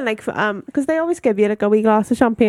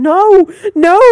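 louder, higher retold no; mock protest with raised pitch; insistent refusal; 6 semitones higher, 6 dB louder than usual. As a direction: imitate her loud, insistent refusal. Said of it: "she kept saying".

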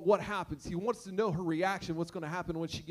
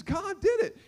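much higher and louder; joyful insistence; 10 semitones higher, 5 dB louder than usual. Retold or imitated. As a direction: exclaim it with triumph. Woman insists to neighbor.

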